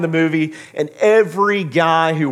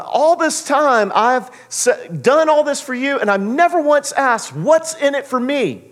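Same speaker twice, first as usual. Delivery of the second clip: yelling; 11 semitones higher, 3 dB louder than usual. Retold or imitated. Ray yelling at Shoeless Joe about his work, aggrieved. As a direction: pour out years of resentment. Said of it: "he's yelling about it".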